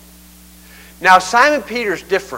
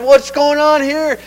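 higher, drawn-out puzzled question; baffled; 7 semitones higher, slower than usual. Retold or imitated. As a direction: voice an apostle's mock bafflement, pitched up. Peter acted out as baffled.

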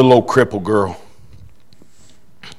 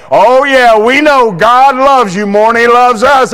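quoting church folks; 10 semitones higher, 7 dB louder than usual. Retold or imitated. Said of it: "they say it".

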